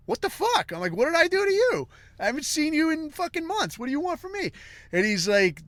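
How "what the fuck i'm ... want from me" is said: shocked outburst. Retold or imitated. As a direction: voice shoots much higher in baffled disbelief. Friend suddenly curses at him.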